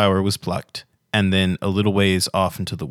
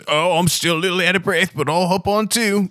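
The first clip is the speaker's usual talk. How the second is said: high, wavering character voice; winded Pavilion; 10 semitones higher than usual. Acted. Pavilion is still out of breath, high game voice. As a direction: pipe up gamely in a high voice. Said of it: "Pavilion says".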